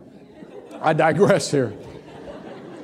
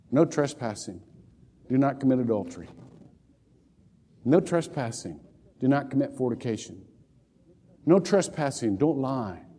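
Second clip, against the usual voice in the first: solemn commandment reading; 6 semitones lower, 6 dB quieter than usual. Acted. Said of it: "God ... has put no trespassing signs".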